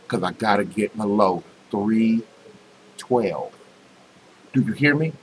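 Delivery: hushed, confiding, leaning close; whisper in your ear. Real, not acted. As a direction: tell it like a secret right in their ear. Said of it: "I'm gonna whisper this in your ear".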